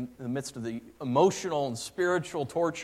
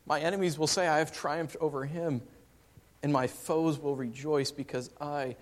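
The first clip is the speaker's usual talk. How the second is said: higher, louder psalm reading; preacher reading a psalm aloud; scripture reading; 4 semitones higher, 5 dB louder than usual. Real, not acted. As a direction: proclaim the psalm. Verse three. reading psalm.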